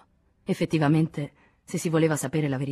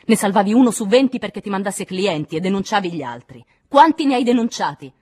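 loud tirade building to a shout; raised, relentless anger; furious accusation; 6 semitones higher, 10 dB louder than usual. A narrator's reading. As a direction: pile on the accusation, then demand. Accuser rejects the excuse.

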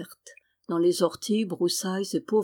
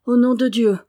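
louder, higher frightened exclamation; frightened cry; 4 semitones higher, 8 dB louder than usual. Acted. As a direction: cry out in sudden alarm. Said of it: "s'écria Jeannine effrayée".

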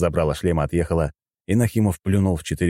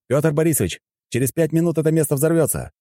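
high-pitched urgent call; urgent warning; 10 semitones higher than usual. A narrator's reading. Fighter warning the admiral of explosion, calling urgently.

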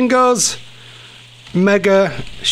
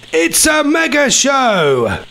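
slow, drawn-out jingle line; jingle voice, less reverb; 6 semitones higher, much slower than usual. Shown as a demonstration. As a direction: say it slow like a station ident. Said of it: "No, a bit more than that".

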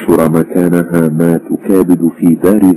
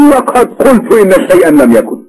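sharp; 3 dB louder than usual. A narrator's reading. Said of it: "قال الرجل في حدة".

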